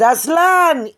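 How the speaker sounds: high call across the field; calling the dog. Retold or imitated. Boy calls his dog Aslan by name, high call.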